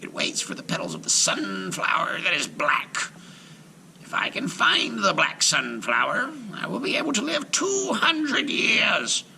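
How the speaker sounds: high witch voice rising to a shout; scheming witch voice. A narrator's reading. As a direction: scheme aloud like a greedy old hag. Baba Yaga voiced.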